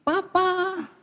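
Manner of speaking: lightly acted wife greeting her husband; a touch of wifely sweetness; submissive wife. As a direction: draw out a hint of a doting wife's call. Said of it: "istrimu sudah begini".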